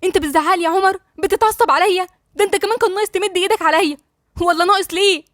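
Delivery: high-pitched, hurt accusation; tearful. A narrator's reading. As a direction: protest through welling tears. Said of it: "والدموع في عينيها وقالت له".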